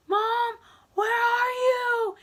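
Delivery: high, drawn-out call for Mom. Retold or imitated. Calling for her mom.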